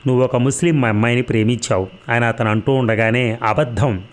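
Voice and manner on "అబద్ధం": ordinary delivery, nothing marked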